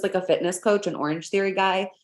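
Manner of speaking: usual speaking voice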